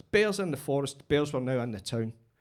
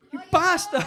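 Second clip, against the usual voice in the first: higher, louder playful yell; mock shout; 12 semitones higher, 7 dB louder than usual. Comedian playfully yelling at an imagined heckler. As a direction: fake outrage at the crowd.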